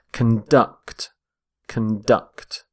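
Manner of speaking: conduct stressed on DUCT, con-DUCT; verb stress shown. Shown as a demonstration. Teacher modelling the verb conduct for learners, stressed con-DUCT. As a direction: say conduct twice, hitting the second syllable DUCT. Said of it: "Stress on the second syllable".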